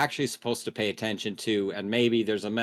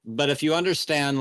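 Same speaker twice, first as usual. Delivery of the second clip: normal talking voice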